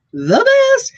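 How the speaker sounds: high, loud, delighted squeal; gushing delight. Real, not acted. Reseller fan raving about hearing that accent, gushing squeal.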